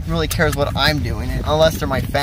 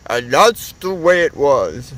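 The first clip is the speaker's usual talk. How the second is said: news anchor sign-off; slower than usual. Shown as a demonstration. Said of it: "Just be like".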